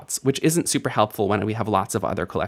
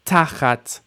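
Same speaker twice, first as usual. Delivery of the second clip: Tachat with the first syllable stressed; initial stress; 3 semitones higher than usual. Shown as a demonstration. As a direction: lean on the opening of Tachat. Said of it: "initial stress, you are correct".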